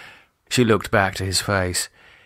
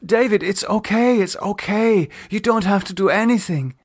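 soothing; 10 semitones higher than usual. Acted. Lisa soothing.

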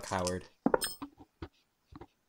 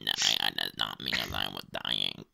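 much lower, wrecked chain-smoker rasp; 4 dB quieter than usual. Pretend. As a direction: croak it like a heavy smoker. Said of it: "Are you the man smoker?".

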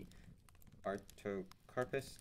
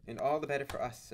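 louder, higher, emphatic retort; assertive; 5 semitones higher, 7 dB louder than usual. A narrator's reading. Ned Land to his companions about the coconuts, assertively. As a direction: assert it with relish. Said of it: "asserted Ned Land".

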